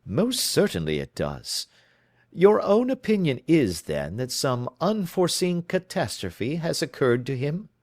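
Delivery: lightly voiced, crisp questioning detective; brisk detective. Holmes questioning his client, crisply. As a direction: probe her story, brisk and in command.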